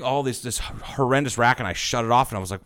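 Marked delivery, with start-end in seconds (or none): none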